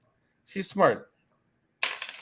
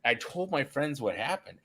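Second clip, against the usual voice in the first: ordinary delivery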